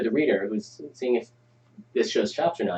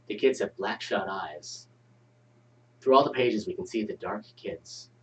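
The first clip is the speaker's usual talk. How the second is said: ominous story narration; 3 semitones lower, 6 dB quieter than usual. Acted. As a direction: read it like a horror story. Reads scene setting ominously.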